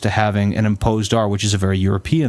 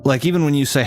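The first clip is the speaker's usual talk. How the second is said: ordinary talk, nothing marked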